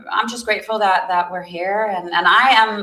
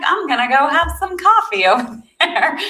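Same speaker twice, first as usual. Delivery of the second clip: chirpy self-quote; 8 semitones higher, 3 dB louder than usual. Retold or imitated. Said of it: "So I'm like".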